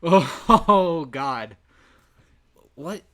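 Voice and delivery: yelled oh god; real loud outburst at the screen; loud exclamation. Real, not acted. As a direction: shout it out in disbelief. Reacting to game.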